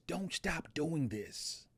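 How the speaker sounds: quietly and slowly, gently repeating her words; soft, slow urging, as if passing on her plea; soft quoted plea. Retold or imitated. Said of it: "She says".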